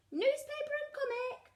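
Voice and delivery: high-pitched piglet announcement; little Peppa. A narrator's reading. Peppa delivers the dogs' order, high piglet voice.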